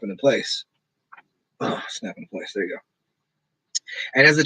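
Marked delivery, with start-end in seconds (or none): 1.6-2.8 unvoiced soft whisper, much softer; whispered aside. Real, not acted. Repeating his own phrase in a whispered aside. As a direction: breathe it to yourself. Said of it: "echoing himself quietly".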